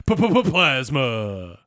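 low, stuttered announcer echo; monster truck ad voice. Retold or imitated. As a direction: boom it out like a rally ad. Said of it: "monster truck rally voice".

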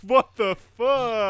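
high, drawn-out mock outrage; exaggerated disbelief. Telling a co-host about Allie's death, exaggerated disbelief. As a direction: stretch it out in high-pitched disbelief. Reacting to shocking wrestling storyline.

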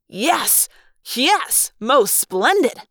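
rising cheer, stretched slow on the last words; excited yell. Acted. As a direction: whoop it up as the beams fire. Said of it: "Marco yelled".

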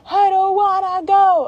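high, drawn-out mock wail; whining child. Daughter refuses to go with mom, whining. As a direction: imitate a kid's stretched-out tantrum whine.

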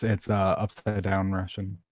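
usual speaking voice

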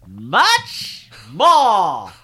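big booming announcer read. Shown as a demonstration. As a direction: boom it out like a trailer voice.

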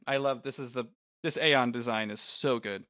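ordinary talk

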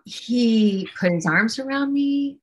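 ordinary delivery

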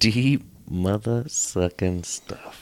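very slow, drawn-out delivery; mock-profound. Host teasing a friend's empty takeaway, mock-profound. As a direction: stretch each word out as if profound.

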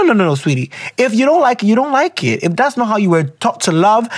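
normal talking voice

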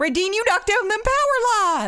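put-on Southern mom scolding her son; scolding country mom. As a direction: scold him like a backwoods mama. Scolding Ray Dean in Southern voice.

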